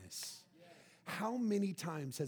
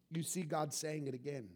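ordinary delivery

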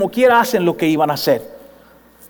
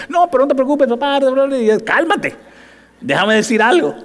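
higher, soothing son calming dad; son's voice; 6 semitones higher than usual. Retold or imitated. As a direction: talk down your worried father. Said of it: "Ya tú". (voz del hijo, aguda, calmando al papá)

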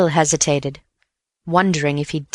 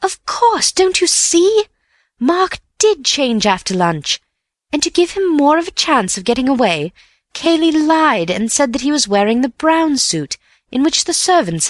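much higher, eager rush of reasoning; insistent and eager; 9 semitones higher than usual. A narrator's reading. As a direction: convince the doubting friend eagerly. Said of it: "insisted Bill".

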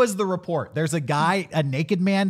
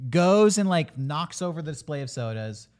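ordinary talk

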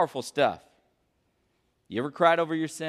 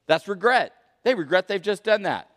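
ordinary delivery

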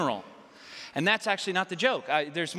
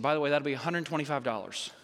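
low, quick, offhand vet; casual billing; 4 semitones lower, faster than usual. Retold or imitated. Vet billing grieving owner, offhand. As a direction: slip the bill in casually, pitched down.

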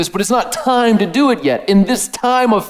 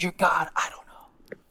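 whisper; 10 dB quieter than usual. Acted. Tempter's whisper.

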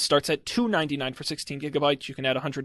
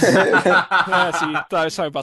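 laughing; 8 dB louder than usual. Real, not acted. Laughing at his own tease.

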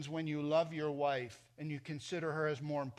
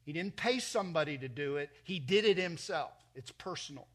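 ordinary delivery

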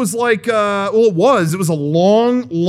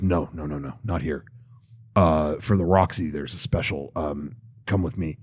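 much lower, much softer attendant; a parking guy leading a driver to a special spot, low and hushed; low, quiet attendant voice; 14 semitones lower, 9 dB quieter than usual. Retold or imitated. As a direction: drop the voice low and hushed like a shady guide.

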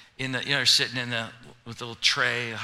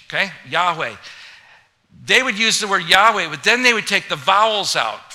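loud, raised-pitch preaching; preaching at full force; 4 semitones higher, 8 dB louder than usual. Acted. Pastor building up to congregation, full-force loud preaching. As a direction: preach it to the back row.

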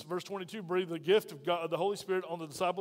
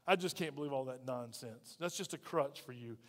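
dismissive scoff; 4 semitones lower, 5 dB quieter than usual. Acted. Voicing a scoffing unbeliever.

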